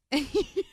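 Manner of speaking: sharp high giggle; high laugh. Real, not acted. Host laughing at the family joke, high giggle.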